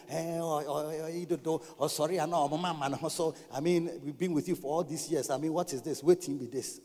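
low, soft mock complaining; imitated grumbling. Associate pastors complaining about their leader, mock low grumbling. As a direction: mumble complaints on a low, even pitch. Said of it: "that's how they talk".

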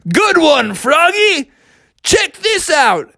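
high, hearty cartoon captain; Captain Crunch. Captain topping the boy's stunt, hearty. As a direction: cheer him on, then show off. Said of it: "And Captain was like".

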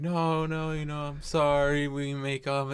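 high, imitated desperate begging; mock pleading. Girlfriend begging him not to leave, high mock pleading. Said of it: "pleading with him".

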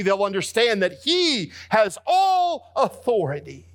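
high, climbing preacher's cry, half-sung; soaring sung preaching peak; preaching at full force. Acted. Preacher builds to climax.

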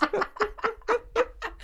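high-pitched helpless laughter; high giggling. Real, not acted. Cracks up at the bus line.

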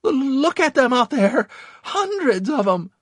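loud, very high shriek; stammering shriek. A narrator's reading. Panicked Victor pointing out the enemy to his squad, shrieked. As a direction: stutter the first word, then shriek it out. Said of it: "he shrieked".

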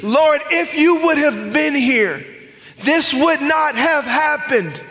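high, loud, slow anguished plea. Acted. Martha pleading with Jesus, slow anguished cry. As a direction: drag it out in grief.

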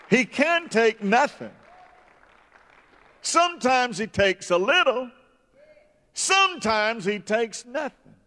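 rising, intoning preacher's cadence; preaching. Acted. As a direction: preach it up high with swelling fervor. Preaching builds in pitch.

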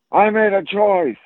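high imitation of a stubborn kid; defiant young child. Four-year-old answering his dad, imitated defiant child.

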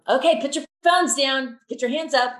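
loud, high call to raise hands; rally the crowd like a children's worship leader; calling out to the room. Retold or imitated. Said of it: "I might very well say".

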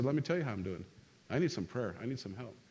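low, soft, fading admission; subdued plea, voice dropping away; honest confession. Acted. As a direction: act someone quietly asking for help. Voices someone honestly admitting need.

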